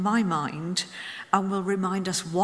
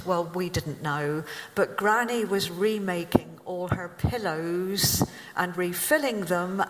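ordinary delivery

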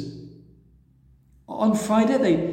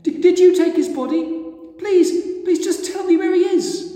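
desperate pleading; 13 semitones higher, 6 dB louder than usual. A narrator's reading. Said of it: "Mary asked".

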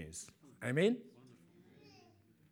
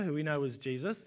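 ordinary talk

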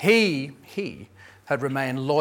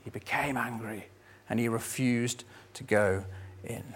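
quieter retelling; 4 semitones lower, 9 dB quieter than usual. Shown as a demonstration. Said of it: "The older brother became angry".

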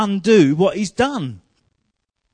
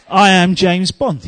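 slow, louder I am James Bond; slow confident mantra; 5 dB louder, slower than usual. Retold or imitated. Preacher at the mirror, slowly convincing himself. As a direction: say it slow, like you finally believe it. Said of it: "Look in the mirror and say".

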